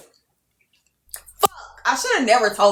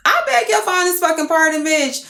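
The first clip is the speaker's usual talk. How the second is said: higher, mock-offended clapback; sassy retort; 8 semitones higher than usual. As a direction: throw it back with mock outrage. Retorting to cousin's insinuation.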